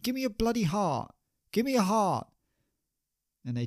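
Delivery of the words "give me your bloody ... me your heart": put-on high, pleading drunk demand; desperate plea. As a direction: beg hard for his heart, pitched up high. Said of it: "And Shakespeare said".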